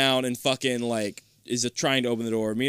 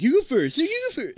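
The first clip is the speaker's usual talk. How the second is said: put-on whiny kid; 3 dB louder, faster than usual. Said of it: "he's like".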